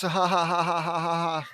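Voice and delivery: long, even run of "ha ha ha"; hammed-up laugh held on and on; mock villain laugh. Reader reacting to Chaos being named, mock villain laugh.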